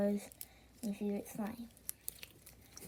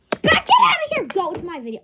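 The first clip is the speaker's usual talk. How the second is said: loud high yell; playful loud shout; 8 semitones higher, 8 dB louder, faster than usual. Real, not acted. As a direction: yell it sharply. Shouted at someone off camera.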